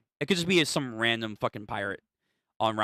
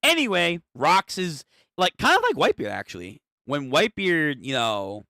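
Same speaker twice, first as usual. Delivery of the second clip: ordinary talk, nothing marked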